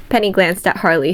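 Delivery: ordinary delivery, nothing marked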